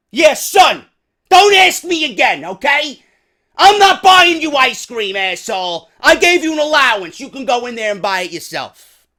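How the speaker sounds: loud, high-pitched scolding shout; irate father. Acted. Dad refusing his son ice cream, angry shouting. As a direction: yell the child down as a fed-up dad. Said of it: "that's not the way I talk to my child".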